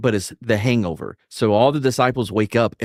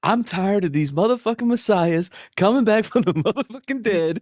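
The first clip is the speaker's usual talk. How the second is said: higher, ranting mock Sam Jackson line; Samuel Jackson riff; 8 semitones higher than usual. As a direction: rant it like an irritated movie badass, pitched up.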